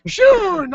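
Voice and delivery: "soon" said high as "shoon"; mocked mispronunciation. Real, not acted. Host making fun of the slip, "soon" as high "shoon". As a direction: repeat "soon" with an exaggerated sh at the front. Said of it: "I wanted to say soon".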